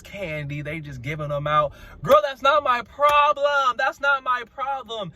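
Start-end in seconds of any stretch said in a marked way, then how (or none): 2.0-5.1 high, loud, slowed-down clapback; indignant high-pitched protest; exasperated retort. Acted. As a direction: push back hard, pitched right up. Answers retold complaint, exasperated.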